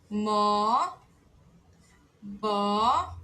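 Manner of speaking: "có, nó, tó, ró, mó, bó" slow, each one set apart; Korean syllables read aloud. Shown as a demonstration. Teacher sounding out new syllables for students, slowly. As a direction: spell out "có, nó, tó" one by one. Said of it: "E agora com a vogal O".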